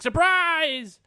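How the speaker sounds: high, loud, drawn-out yell; hammed-up gleeful ambush shout; shouted surprise. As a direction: jump out and holler it. Rita jumps out from hiding.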